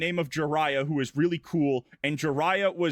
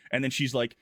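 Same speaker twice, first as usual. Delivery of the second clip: ordinary talk, nothing marked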